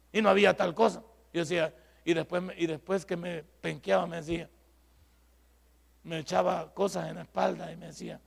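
normal talking voice

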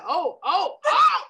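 climbing into a high wailing cry; mock panicked cry. Telling the daughter's fright, a high mock wail. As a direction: ham up a kid's terrified wail.

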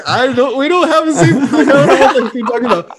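high, loud, laughing denial; laughing panic. Retold or imitated. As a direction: blurt it out through laughter. Panicked denial to alarm company.

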